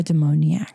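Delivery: demoniac with an extra "ee-ak" ending; mispronounced demonic. Real, not acted. Host slips on the chapter's key word, saying demoniac for demonic. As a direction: say demoniac as de-MOAN-ee-ak. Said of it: "I said it wrong again".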